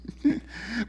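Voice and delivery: short laugh. Real, not acted. Laugh alone.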